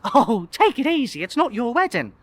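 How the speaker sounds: receptionist voice. Acted. Receptionist to nervous guest, high chiding voice.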